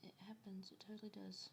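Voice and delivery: soft unvoiced whisper. Real, not acted. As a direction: whisper it like a confession.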